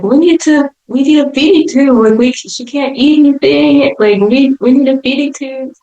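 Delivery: high-pitched, urgent pleading; imitated mom pressing her case; insistent mom. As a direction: plead like a worried mother.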